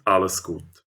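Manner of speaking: ordinary delivery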